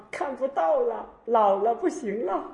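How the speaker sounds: put-on high, drawn-out old person's excuse; complaining old devotee. Old devotee explains failing eyesight to master, complaining.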